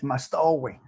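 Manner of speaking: imitated Sicilian accent; Giacomo. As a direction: say "Mastowi" as a Sicilian would. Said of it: "in his Sicilian accent".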